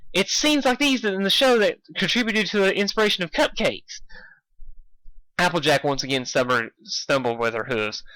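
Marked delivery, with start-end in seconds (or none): 0.1-4.0 put-on high, bubbly pony girl; chirpy pitch way up; Pinkie's voice. A narrator's reading. Narrator voicing Pinkie calling out to Dashy, high.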